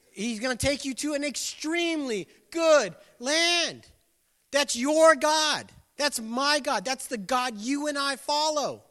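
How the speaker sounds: forceful pulpit voice; impassioned preaching. Real, not acted. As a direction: preach it with rising urgency, drawing out key words.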